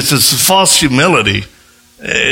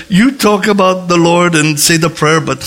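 tag; 7 semitones higher than usual. Retold or imitated. Acting out bodyguard's explanation, amused.

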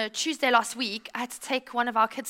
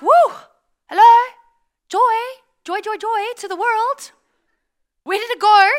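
high, loud mock holler for someone missing; calling out searchingly; 9 semitones higher, 12 dB louder than usual. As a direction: call out as if hunting for something lost.

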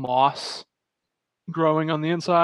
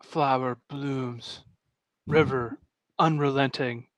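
mock haiku recitation; 4 dB quieter, slower than usual. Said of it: "'cause it's just like".

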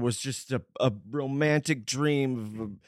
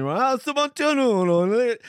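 put-on Italian accent; 12 semitones higher, 8 dB louder, slower than usual. Mocking Italian greeting.

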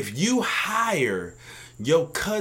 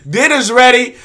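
loud, high call across the house; 8 semitones higher, 13 dB louder than usual. Pretend. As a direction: yell it like a parent calling everyone in. Acting out a family dinner call.

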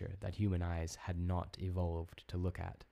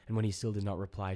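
plain reading voice

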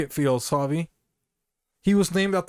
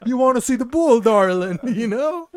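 mock Italian accent; 5 semitones higher, 5 dB louder than usual. Continues mock Italian come-on.

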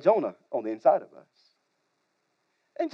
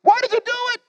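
much higher, whining groan; groaning sailors; 14 semitones higher, much faster than usual. Acted. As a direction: plead with a high groan. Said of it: "They groaned".

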